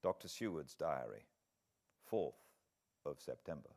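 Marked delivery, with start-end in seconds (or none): none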